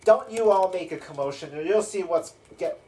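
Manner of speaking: acted threat. Spine devil warning the remaining prisoners, acted loud threat. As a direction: warn all the prisoners off.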